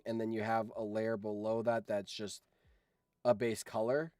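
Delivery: usual speaking voice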